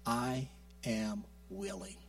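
quiet and slow, God's tender yes; soft, slow divine reassurance. Pretend. God answering the sick, slow and soft. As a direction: breathe it out gently and slowly. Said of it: "the power that says".

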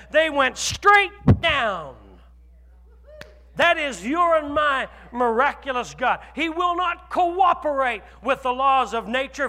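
loud, slow preaching with shouts; fiery sermon proclaiming God's power; preaching at full force. Acted. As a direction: thunder it from the pulpit. Preaching the walls of Jericho.

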